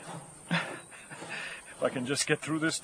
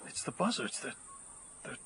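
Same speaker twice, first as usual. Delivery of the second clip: whisper; 13 dB quieter than usual. Acted. Frightened man sees talking buzzards.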